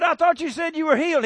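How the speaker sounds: a bartender's indignant yell; exasperated bartender yell. Retold or imitated. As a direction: shout it betrayed, beer dripping. Bartender gets beer in face again.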